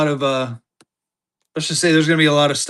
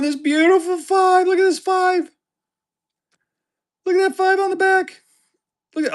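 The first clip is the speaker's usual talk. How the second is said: gushing excitement; 13 semitones higher than usual. Real, not acted. Cooing over a favorite note.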